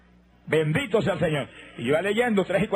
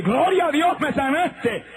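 much higher, louder burst of joy; joyful cry; 9 semitones higher, 3 dB louder, slower than usual. Retold or imitated. Healed man shouting thanks to God, joyful cry. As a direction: cry out thanks, overwhelmed with relief.

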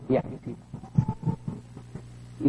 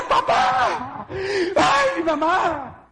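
high, crying screams for papá and mamá; screaming for parents; 14 semitones higher, much slower than usual. Retold or imitated. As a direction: scream for help in anguish. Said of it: "Y empecé a gritar". (grito llorando por papá y mamá)

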